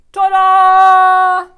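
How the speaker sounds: high loud held fanfare; magical ta-da. A narrator's reading. Mister Noogie casts his spell, loud high fanfare. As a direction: sing it out like a magician.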